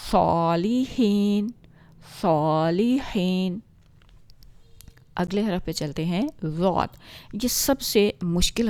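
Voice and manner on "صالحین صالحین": صالحین drawn out very slowly with a heavy ص; slow heavy recitation. Shown as a demonstration. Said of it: "باری آواز". (صالحین آہستہ موٹی آواز میں)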